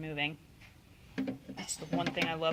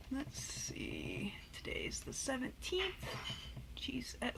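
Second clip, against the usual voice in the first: whispering; 4 semitones higher, 13 dB quieter, slower than usual. Real, not acted. Muttering while noting weight.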